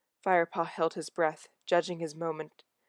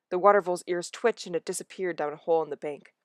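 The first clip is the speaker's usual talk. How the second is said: usual speaking voice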